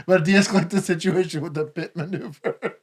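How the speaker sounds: laughing through it; giggling while joking. Real, not acted. Joking about pit maneuver.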